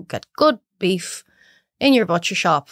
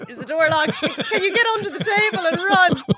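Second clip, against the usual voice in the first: laughing, higher mock panic; mock alarm; 10 semitones higher than usual. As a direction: joke about fleeing, laughing.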